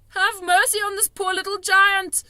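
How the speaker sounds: high, loud frantic pleading; desperate begging. A narrator's reading. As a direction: plead for mercy in panic. Giant begging in nightmare.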